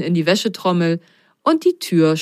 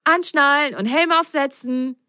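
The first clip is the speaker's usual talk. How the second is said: grinning; 7 semitones higher than usual. A narrator's reading. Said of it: "grinste Ben".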